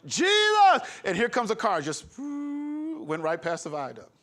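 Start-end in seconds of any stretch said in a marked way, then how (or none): 0.1-0.8 loud high yell of Jesus; hollered cry. Retold or imitated. Screaming as the car heads for the viaduct. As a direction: holler it in panic. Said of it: "I screamed it, I hollered it".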